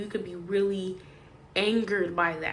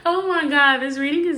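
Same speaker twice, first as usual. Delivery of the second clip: amused exclamation; 6 semitones higher, 9 dB louder than usual. Real, not acted. Reacting to her weird reading.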